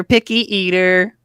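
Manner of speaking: mocking taunt, rising. Harmon rubbing it in to Haskin, rising teasing taunt. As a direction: repeat the jab with a teasing lift. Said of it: "points at him and laughs and says".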